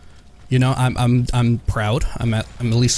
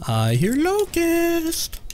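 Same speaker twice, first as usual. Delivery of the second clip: high, slow, drawn-out call; playful singsong; much slower than usual. Pretend. Gamer calling out nearby enemies, playful singsong. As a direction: stretch it out high like a teasing singsong.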